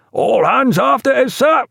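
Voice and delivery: high, called-out acknowledgement; high deckhand's call; boatswain's reply. A narrator's reading. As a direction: sing it back high to the officer. Boatswain acknowledges order on deck.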